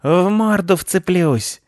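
high, drawn-out howl; slow, screeching cat threat; startled yell. A narrator's reading. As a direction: threaten while yelling in shock. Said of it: "Под окном завопил от неожиданно свалившейся".

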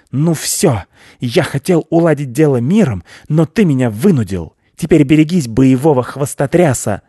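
high, loud blustering threat; worked-up hare, shouting high; battle-ready hare. A narrator's reading. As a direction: declare war in a small animal's squeal. Hare challenges wolf to battle.

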